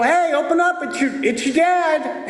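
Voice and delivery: high, calling at a locked door; calling through door. Retold or imitated. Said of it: "And I go".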